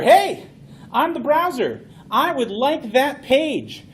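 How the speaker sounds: bright, high, slow browser voice; voicing the browser. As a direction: play the browser politely asking for a page. Browser acts chatty conversation.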